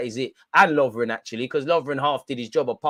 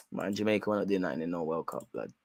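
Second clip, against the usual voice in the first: resigned mutter; 8 semitones lower, 8 dB quieter than usual. Real, not acted. Resigned aside about Jamaica.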